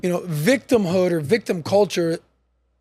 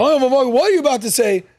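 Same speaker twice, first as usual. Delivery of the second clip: emotional; 5 semitones higher than usual. Retold or imitated. Retelling his retort to the MAGA guy, emotional. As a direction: fire it back, worked up, in retelling. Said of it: "this is me being emotional".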